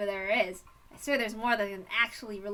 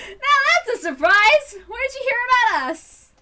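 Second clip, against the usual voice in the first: delighted surprise; 11 semitones higher, 13 dB louder than usual. Acted. Club leader surprised by a newcomer, loud and high. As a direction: crow with amazement at full volume.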